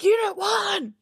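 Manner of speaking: high-pitched mock holler; imitating a scream. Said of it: "hear this person scream out".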